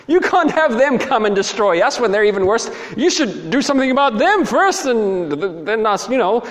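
high, loud pleading argument; indignant protest. Acted. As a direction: argue back at God in disbelief. Prophet keeps protesting to God.

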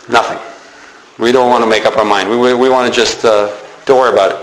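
low, evasive reply, well under the preacher's pitch; noncommittal mumbling crowd. Acted. Israelites dodging Elijah's demand to choose, low and noncommittal. People shrug off Elijah's challenge.

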